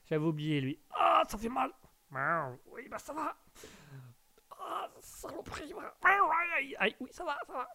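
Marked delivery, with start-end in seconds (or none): 0.9-3.4 high pained squeak. Real, not acted. Cat hurts him, pained voice. 4.6-5.7 high, soft yelp of pain; pained cry. Real, not acted. Streamer scratched by his cat, yelping in pain. 5.7-7.7 high yelping aïe, aïe; pained yelps. Real, not acted. Streamer hurt by his cat, high pained yelps.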